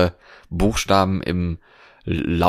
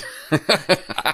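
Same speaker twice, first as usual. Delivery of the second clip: giggle; 8 semitones higher than usual. Real, not acted. Laughs at her own clumsiness.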